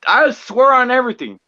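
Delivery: high, loud, exasperated oath; emphatic retold swearing. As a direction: swear it with heated disbelief. Acting out own disappointed reaction.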